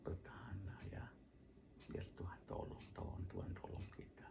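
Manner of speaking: soft, whispered encouragement; hushed tender plea to the wife; coaxing. Retold or imitated. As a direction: softly persuade her to hold on. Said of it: "saya rayu dia".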